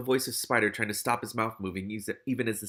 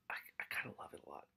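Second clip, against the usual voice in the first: hushed, quick real whisper; whispered confession; 6 semitones lower, 18 dB quieter, much faster than usual. Real, not acted. Reader confessing a guilty delight in a book scene, whispered. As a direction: admit it in a fast whisper.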